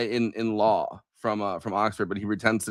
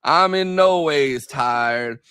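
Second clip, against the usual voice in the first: very slow, higher mock Southern preacher accent; Hillary Clinton's fake preacher accent; 4 semitones higher, 5 dB louder, much slower than usual. Mimicking a candidate talking to a church, fake preacher accent. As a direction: stretch every word out in a put-on church-preacher accent. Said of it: "preacher accent".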